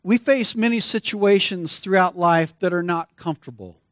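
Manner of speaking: ordinary talk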